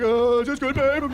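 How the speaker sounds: high, loud, eager-to-please put-on voice; mock posing fiancé. Groom posing on a pumpkin for photos, high mock eager. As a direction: play the dopey boyfriend asking his girl.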